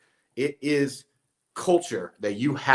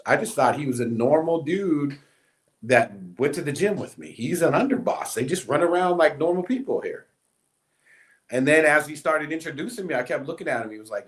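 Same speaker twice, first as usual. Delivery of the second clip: normal talking voice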